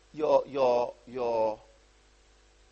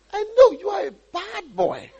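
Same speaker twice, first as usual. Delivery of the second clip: high, mock-scolding accusation; acted scolding; 13 semitones higher, 4 dB louder than usual. Brother accusing young David before the battle, scolding.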